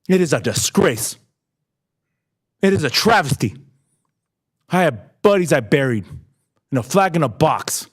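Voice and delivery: fighting to hold it together while speaking of fallen friends; getting emotional. Real, not acted. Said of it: "I'm sorry I'm getting emotional".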